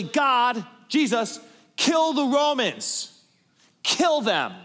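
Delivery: loud, high, fierce crowd cry; crowd demand. Retold or imitated. Crowd urges Jesus against Rome, loud and fierce. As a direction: demand it like a mob.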